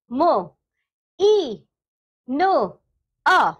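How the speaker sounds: "मो, ई, नो, अ" as separate sounds with pauses between; sound-by-sound breakdown. Shown as a demonstration. Teacher splitting मीना for children, sound-by-sound breakdown. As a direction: break it into "मो", "ई", "नो", "अ", each sounded alone. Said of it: "मीना का जब वर्ण विच्छेद किया".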